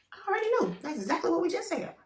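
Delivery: high, soft told-you-so; knowing and smug. Real, not acted. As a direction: sing it quietly, I knew it. Smug aside to a viewer.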